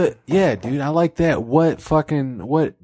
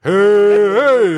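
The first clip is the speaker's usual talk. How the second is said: loud, higher drawn-out heys; God calling hey; 5 semitones higher, 6 dB louder than usual. Acted. God answers a man praying, loud higher heys. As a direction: call back hey from on high. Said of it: "God come back and say".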